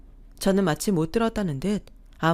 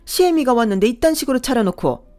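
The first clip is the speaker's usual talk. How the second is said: loud, much higher outraged yelling; furious mother-in-law; 5 semitones higher, 10 dB louder than usual. Acted. Mother-in-law yelling at daughter-in-law over the meagre dinner, furious. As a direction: explode at the insolent answer.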